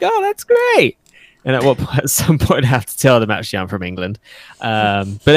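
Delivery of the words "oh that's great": much higher, delighted mock gush; gushing stranger. A stranger hearing New South Wales, gushing high and thrilled. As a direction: play the impressed stranger who bought it. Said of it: "And they're like".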